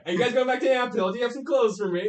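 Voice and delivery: high, loud eager questions; high stranger voice. Acted. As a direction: ask for clothes in a high cheerful voice. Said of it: "he's like".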